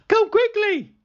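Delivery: high, loud call for help; high, loud urgent cry; urgent shout. Acted. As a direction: shout them up the hill. Boy pleading for help.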